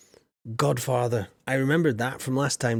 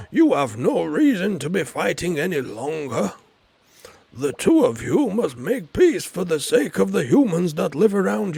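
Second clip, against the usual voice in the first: louder, higher, grand lecturing tone; solemn wizard; 4 semitones higher, 5 dB louder than usual. Acted. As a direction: scold them like a wise old wizard.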